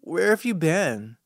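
slow, slurred tipsy question. Acted. Tipsy mom to her son coming home, slurring slowly. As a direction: let the wine slur the words. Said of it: "She slur asks".

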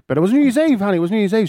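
high-pitched, protesting excuse; insistent self-justification to his kid; defensive. Retold or imitated. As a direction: plead your case, pitched well up. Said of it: "I love the way you're defending".

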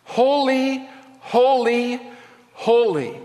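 HOLY, HOLY, HOLY high-pitched, each held and set apart; emphatic threefold repetition. Acted. Angels repeating Holy in the throne room, high emphatic proclamation. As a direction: ring out each "Holy" high, easing down on the last. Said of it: "They repeat it three times".

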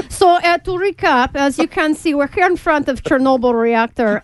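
put-on Russian accent. Reporter filling airtime outside the reactor, mock Russian accent. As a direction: stall for time in a hammed-up Russian accent. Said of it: "continuing coverage for the Russian news stations".

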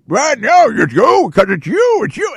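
high-pitched sputtering accusation; mock yelling. Host imitating the president at a reporter, mock yell. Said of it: "he yells at Peter Doocy".

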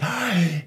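high, acted gasp of realisation; startled gasp. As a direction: gasp as the villagers suddenly realise. People startled awake in story.

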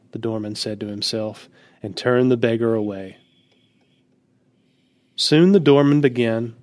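usual speaking voice

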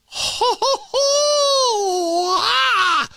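high, shouted ho ho ho cackle ending in a sharp ah; mocking laugh. Real, not acted. Host mocks a candidate's slavery answer with a high scornful ho ho ho laugh. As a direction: belt out a high scornful ho ho ho, then cry ah.

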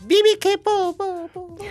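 very high, loud sing-song call; squeaky high call. Acted. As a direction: squeal the name out. Guest's stage name, squeaky call.

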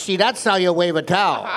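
put-on loud, gloating husband schooling the kid; triumphant punchline. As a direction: belt out the boast. Joke punchline, husband boasts to kid.

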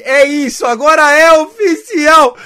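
near tears of joy, loud; crying out with joy; overjoyed. Real, not acted. Trade officially announced, overjoyed.

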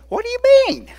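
high, loud disbelieving cry; the Lord puzzled at the crying; incredulous question. Acted. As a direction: shoot it up in sheer disbelief. Preacher voicing God's puzzled question.

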